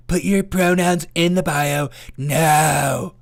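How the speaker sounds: bossy and offended, as a caricature; angry leftist voice. Retold or imitated. Mocking a leftist demanding pronouns, indignant put-on voice. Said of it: "That's my angry leftist voice".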